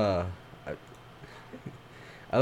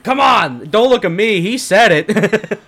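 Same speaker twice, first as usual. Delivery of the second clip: higher, louder, whining protest; mock indignant; 7 semitones higher, 7 dB louder than usual. Comic protesting to the crowd, mock indignant and loud. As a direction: play the angry comic who blames someone else, louder. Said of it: "they're like".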